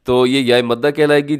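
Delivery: normal talking voice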